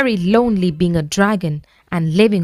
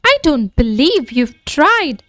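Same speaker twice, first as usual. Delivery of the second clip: crossly; 7 semitones higher than usual. A narrator's reading. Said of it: "said the dragon crossly".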